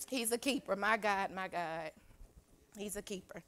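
very soft, low murmur of praise; hushed awe. Real, not acted. Preacher moved that God keeps her safe, hushed and low. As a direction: drop right down, as if to yourself.

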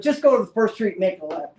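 loud, high imitation of a fed-up local; retold exasperated outburst; exasperated old man. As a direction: snap directions like an angry old man. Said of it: "this old man was ready to kill me".

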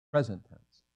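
ordinary delivery

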